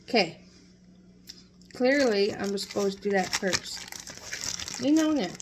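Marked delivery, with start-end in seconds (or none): 2.3-5.3 talking while licking fingers. Real, not acted. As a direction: speak around fingers in mouth. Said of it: "Sorry for licking my fingers".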